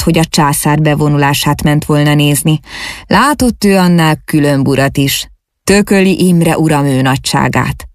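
usual speaking voice

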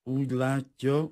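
unperturbed. A narrator's reading. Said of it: "folytatta Kunzler Adolf zavartalanul".

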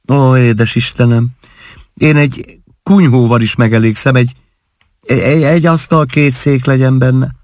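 lightly acted wifely gushing; gushing bride. A narrator's reading. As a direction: gush sweetly over modest wishes. New bride gushing modest wishes.

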